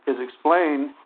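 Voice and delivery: normal talking voice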